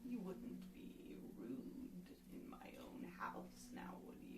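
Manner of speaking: soft croaking hag whisper; old hag. Acted. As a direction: coax them like a sly old witch. GM voices the hag.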